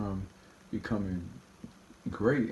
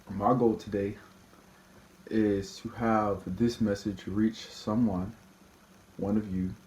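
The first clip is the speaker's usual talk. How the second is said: ordinary talk, nothing marked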